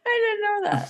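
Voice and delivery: high, laughing; laughing reply. Real, not acted. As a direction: giggle out the words.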